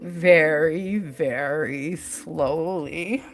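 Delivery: low, soft, long-drawn words; very slow. Retold or imitated. Recalling the monk's gentle penance, very slowly. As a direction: quote it softly, stretching each word right out. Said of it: "wish I could say it in his accent".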